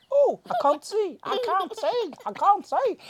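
high, loud, squealing little voice; trapped toy cry. Pretend. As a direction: squeal from under the blanket. Toy trapped under blanket, crying out.

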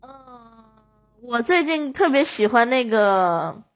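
ordinary talk, nothing marked

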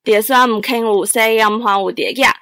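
northern-accented Teochew; Teochew dialect. Shown as a demonstration. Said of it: "那你就随便来两句".

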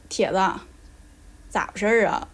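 northeastern Chinese accent. Shown as a demonstration. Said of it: "整个人就掉下去了".

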